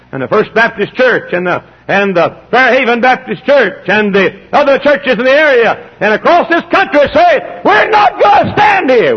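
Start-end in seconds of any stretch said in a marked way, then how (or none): 1.9-9.2 slow, high, rising to a shout; rally the churches to battle; rousing pulpit battle cry; full-force preaching. Real, not acted.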